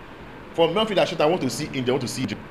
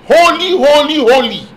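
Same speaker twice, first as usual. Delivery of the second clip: seraphs' mighty cry; 9 semitones higher, 10 dB louder than usual. Acted. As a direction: ring out holy like heaven's choir. Seraphs' cry acted again.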